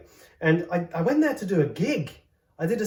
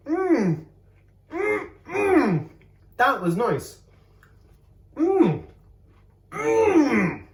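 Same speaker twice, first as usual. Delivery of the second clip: high, louder hums of delight; high pleased mmm; 9 semitones higher, 4 dB louder, much slower than usual. Real, not acted. Savouring a bite on camera, high delighted humming. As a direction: hum with pleasure at each bite.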